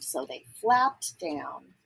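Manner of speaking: plain reading voice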